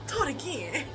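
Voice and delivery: rising from soft to high; soft plea climbing into a high squeal; giddy plea. Retold or imitated. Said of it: "I said".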